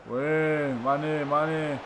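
usual speaking voice

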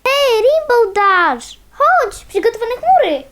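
high, bright call to a friend across the sky. Acted. Pony calling Rainbow Dash over to the clouds, loud call. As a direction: shout her over, slowly stretching the name.

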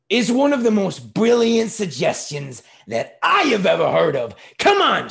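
high, booming mock praise; loud sarcasm. A narrator's reading. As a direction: praise it at full volume with scorn. Father loudly sarcastic to waiter.

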